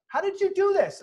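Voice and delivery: high, loud mock amazement; retold gasp of wonder; amazed question. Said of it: "people were like".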